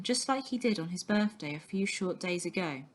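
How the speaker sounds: ordinary delivery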